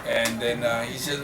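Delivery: usual speaking voice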